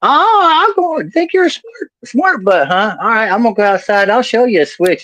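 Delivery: high, hammed-up indignant granny; mock-menacing granny squawk; mean old grandma. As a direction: threaten in a high old-lady voice. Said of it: "she used to be a mean old lady".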